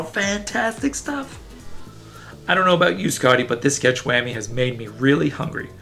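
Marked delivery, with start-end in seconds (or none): none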